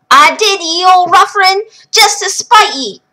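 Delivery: high, loud tart brogue; feisty old wife. Acted. Wife scolding her long-gone husband, high feisty. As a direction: spit it back at him. Liv retorts.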